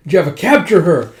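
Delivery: forceful villain's command; barked order. Acted. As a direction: order the henchmen to seize someone.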